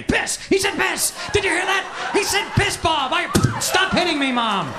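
put-on high, shouting giggly kid; a bored kid thrilled by a rude word; excited nine-year-old. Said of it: "you're nine years old".

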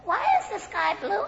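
put-on high, soft toddler question; curious small child. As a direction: ask in a tiny, high child's voice. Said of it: "He looks up".

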